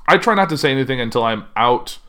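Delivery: ordinary delivery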